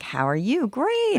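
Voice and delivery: a hint of fake brightness, "Great" jumps very high; mock cheerful. Mom at the playground greeting another, fake cheerful. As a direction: imitate a mom pretending all is fine.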